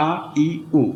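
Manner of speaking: আ, ই, উ said quick and short, no stretching; short vowels. Shown as a demonstration. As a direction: clip each vowel আ ই উ short. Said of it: "আমাদের খুব তাড়াতাড়ি বলতে হবে". (আ, ই, উ দ্রুত ও ছোট করে)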